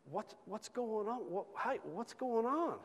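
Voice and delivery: high, soft, careful questioning; concerned appeal. Retold or imitated. Coworker to a driver in distress, high soft concern. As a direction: gently plead to know what's wrong.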